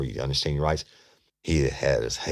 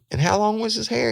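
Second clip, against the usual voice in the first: leading question. Retold or imitated. Detective leads the suspect on.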